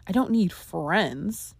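"friends" drawn out with emphasis, slowly; stress on FRIENDS. Shown as a demonstration. Showing listeners the third inflection, stress on "friends". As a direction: lean on the last word, "friends". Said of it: "those who stress the last word".

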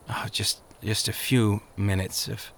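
unvoiced, drowsy mumble; sleepy mumble. A narrator's reading. Faithful dozing under the tree begs a few minutes in a sleepy whisper. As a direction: drift off mid-word, barely voiced.

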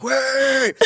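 high, stretched-out mock holler; retold yell. Guard running over at the car, high mock yell. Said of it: "the guy comes running over like".

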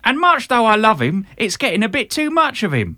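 hammed-up Britpop South London; really [name] it up, loud and high; South London accent. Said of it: "I'll do the South London".

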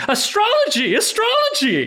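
high-pitched excited call-out; retold excited shouting. Host recalls hailing the astrologer on the street, excited high shout. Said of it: "So I just started shouting".

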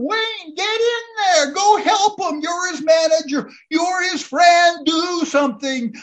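high urgent yelling, pleading; kids screaming. Retold or imitated. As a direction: scream at the manager to act. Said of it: "The kids are screaming".

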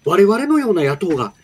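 impassioned, high-pitched Kansai-tinged political speech; Yamamoto Taro impression. Announcer obliges a listener's request for a politician, impassioned high-pitched impression. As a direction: plead like a fiery opposition politician, pausing between bursts. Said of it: "某山本さんのモノマネ".

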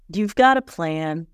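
usual speaking voice

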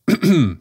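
sharp throat clearing; just swallowed a bug. Real, not acted. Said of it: "a bug just flew in my mouth".

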